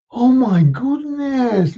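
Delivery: drawn-out high oh of amazement; slow awed wonder. Acted. Ricky overwhelmed by Santa's surprise. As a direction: let the oh stretch in wonder.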